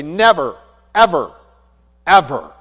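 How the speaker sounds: loud, punched words with falling endings; emphatic. Retold or imitated. Pastor answering the nurse's routine question, emphatic. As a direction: hit each word separately and hard, leaving no doubt. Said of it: "That's emphatic".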